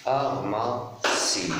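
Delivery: "pharmacie" very slow, with pauses between syllables; split into syllables. Shown as a demonstration. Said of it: "je découpe le mot". (« pharmacie » très lentement, syllabe par syllabe)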